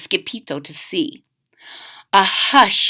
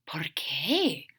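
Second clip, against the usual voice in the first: small frightened whisper; 6 semitones lower, 11 dB quieter than usual. Acted. Skipito whispering a nervous question. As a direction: breathe the question.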